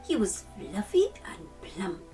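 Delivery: usual speaking voice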